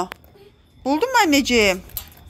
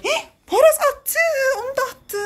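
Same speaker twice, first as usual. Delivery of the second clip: high, gasping alarm; gasp, then exclaim in a high voice; aghast, pitch shooting up; shocked dismay; 13 semitones higher than usual. Real, not acted. Watches child throw another toy out.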